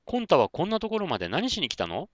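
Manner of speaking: put-on high, curious little-girl kitten voice; the kitten asks Konta why he came, in a high voice. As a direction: ask like a small curious kitten. Kitten character voice.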